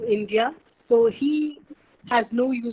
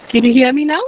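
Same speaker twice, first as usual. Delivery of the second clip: louder into the mic; 10 dB louder, faster than usual. Real, not acted. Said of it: "Ha, this is better".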